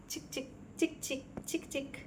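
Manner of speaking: soft unvoiced chik chik clicks; clicking tongue sounds. Real, not acted. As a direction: click at the bird to call it. Clicking noises to the bird. (చిక్ చిక్ అని మెల్లగా నాలుకతో శబ్దం)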